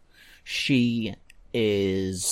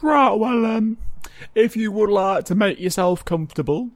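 high, louder awkward official voice; guard character; 4 semitones higher, 5 dB louder than usual. Acted. Guard tells the injured visitor to get comfortable, officious. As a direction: put on an officious, hesitant guard.

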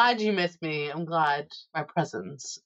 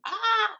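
silly scream. Retold or imitated. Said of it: "confined in the car going".

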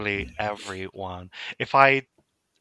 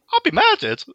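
much higher squeaky mock panic; 7 dB louder than usual. Real, not acted. Joking that a husband will murder her, high yelp.

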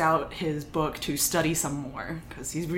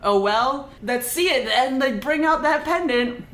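a bit higher, louder sneering challenge; mock angry taunt; 8 semitones higher, 4 dB louder than usual. Angry disciple demanding the pendant, mock taunting. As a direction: taunt him, mildly riled up. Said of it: "Ming Fan gets angry and is like".

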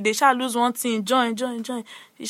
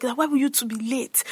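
ordinary delivery

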